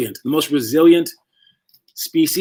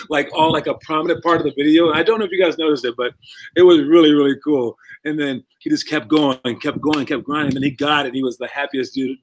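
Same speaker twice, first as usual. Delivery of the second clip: usual speaking voice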